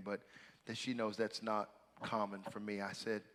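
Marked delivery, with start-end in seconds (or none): none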